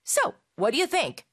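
"what do you" run together as "whaddya" after "So". Shown as a demonstration. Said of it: "조금 더 빨리 발음하면".

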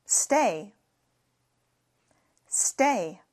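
"Stay" said alone, slow and clear; model pronunciation. Shown as a demonstration. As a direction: give "Stay" as a clean model word. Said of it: "계속 있다". ("Stay" 또박또박 발음)

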